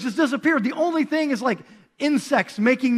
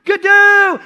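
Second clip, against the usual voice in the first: high, full-volume long holler; acted desperate yell for a brother; 9 semitones higher, 11 dB louder, much slower than usual. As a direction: holler to someone far across a field. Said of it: "he'll yell out the window".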